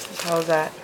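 ordinary talk

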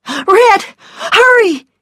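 high, piercing cry of alarm; loud, panicked, high-pitched yell; urgent shout. A narrator's reading. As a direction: shout for him to get moving. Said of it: "she shouted".

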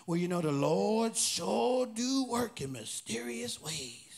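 slow, drawn-out mock-pious saying; exaggerated slave voice, stretched right out. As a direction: make fun of a pious cliché, dragging the words. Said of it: "this is my slave voice".

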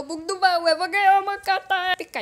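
high, loud burst; excited boast. Real, not acted. Gamer expects an epic card, excited loud boast. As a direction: get carried away, high and loud.

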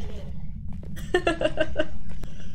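an airy wheezing laugh; breathy laugh. Real, not acted. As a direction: laugh without much voice. Laughs at own joke.

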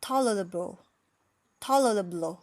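usual speaking voice

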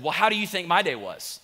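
retold snappy retort to a spouse; firing off. Husband back from preaching to his wife, firing off. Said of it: "I just fired off of the mouth again".

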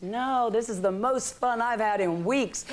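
a child who refuses to get out of the sea, mock pleading. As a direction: play the four-year-old begging to stay in. Daughter refuses to leave water.

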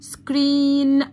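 "screen" held level as a single syllable; one unbroken syllable. Shown as a demonstration. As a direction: say "screen" all in one long piece. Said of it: "اهو نطقتها مرة واحدة". ("screen" مقطع واحد ممدود متصل)

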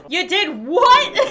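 laughing, slow and loud; cracking up in disbelief. Real, not acted. Laughing at the friend who sold their soul, loud.

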